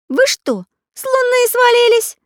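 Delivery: very high, loud cartoon astonishment; startled. A narrator's reading. Pencil character gawps at dangling pirates, startled. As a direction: blurt it out, pitch leaping up. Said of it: "от неожиданности промолвить Карандаш".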